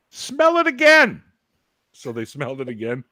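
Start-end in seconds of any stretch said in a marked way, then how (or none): 0.1-1.2 high, loud and drawn-out; slow it down and push it up high, acting exasperated; retold insistent shout. Teen challenging dad over the doobies, retold insistent shout.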